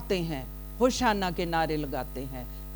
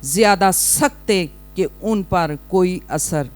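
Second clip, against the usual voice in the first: loud, raised preacher's voice; full-force preaching; 5 semitones higher, 13 dB louder than usual. Real, not acted. Preacher asking the congregation to read the verse, loud preaching. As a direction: ring it out to the congregation.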